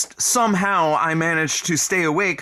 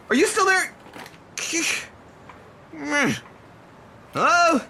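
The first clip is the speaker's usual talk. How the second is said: calling out; 11 semitones higher than usual. Acted. Calling after someone who left.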